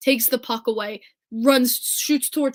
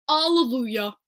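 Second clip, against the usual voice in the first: ordinary talk, nothing marked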